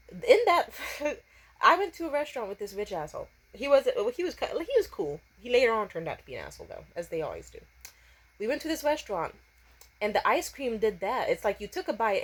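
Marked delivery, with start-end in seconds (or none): none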